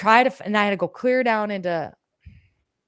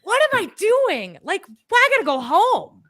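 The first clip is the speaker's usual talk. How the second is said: panicked self-talk; 11 semitones higher, 7 dB louder than usual. Retold or imitated. Retelling panic on the road.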